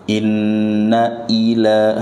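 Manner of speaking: Inna ila slow, hamzah of ila cleanly stopped; firm hamzah. Shown as a demonstration. Teacher showing students the firm hamzah. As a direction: hit the hamzah crisply, no y glide. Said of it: "hamzah tegas".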